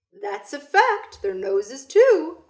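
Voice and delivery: put-on high, loud, slow old man; blind man. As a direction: savour each word like a wise old man. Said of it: "the man said".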